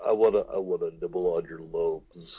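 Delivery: low, soft, creepy lover voice; creepy seductive whisper. Pretend. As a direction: murmur it like a greasy seducer.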